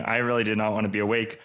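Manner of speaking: normal talking voice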